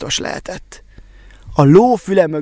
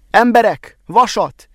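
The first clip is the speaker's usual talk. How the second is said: higher, louder call to the villagers; shout to the crowd; 6 semitones higher, 3 dB louder than usual. A narrator's reading. The smith shouts to the villagers outside, asking loudly for iron. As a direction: call out over the forge noise. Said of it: "kiáltotta Szűcs Lajos, jó hangosan".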